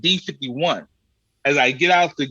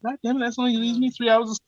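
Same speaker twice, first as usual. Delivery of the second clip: high, fast fed-up groan; fed-up cursing; exasperated curse; 9 semitones higher, faster than usual. Retold or imitated. Cursing the early flight.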